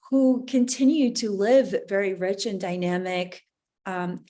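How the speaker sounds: ordinary delivery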